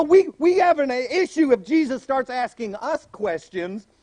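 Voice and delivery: ordinary delivery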